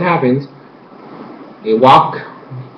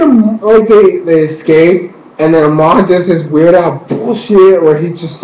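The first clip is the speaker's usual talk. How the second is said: ordinary delivery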